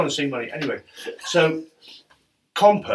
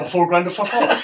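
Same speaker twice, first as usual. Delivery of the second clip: punchline through a laugh, louder; 6 semitones higher, 7 dB louder than usual. Real, not acted. Finishing the money story for friends, laughing. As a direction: laugh the payoff out.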